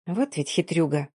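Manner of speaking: softly, under the breath; quiet whisper to herself. A narrator's reading. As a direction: mutter it half to yourself. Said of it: "тихо шепнула себе под нос".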